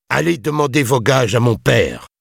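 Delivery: high, impatient retort; exasperated. A narrator's reading. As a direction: fling it at him, fed up. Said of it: "lança William excédé". (excédé, voix haut perchée)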